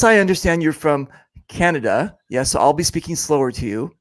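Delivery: ordinary delivery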